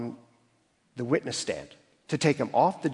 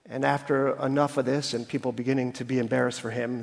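ordinary talk, nothing marked

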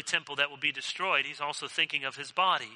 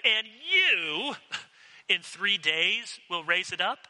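you lifted high with a rising, incredulous tune; stress on you; 3 semitones higher, slower than usual. Shown as a demonstration. Preacher showing the emphatic Greek word order, stressing you. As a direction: lean hard on you, then slow the rest. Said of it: "the placement is emphatic".

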